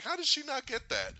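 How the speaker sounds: high-pitched baffled question; incredulous. Retold or imitated. Viewer baffled that Gamora missed the obvious, incredulous. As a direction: squeal it in mock disbelief. Retells own disbelief at character.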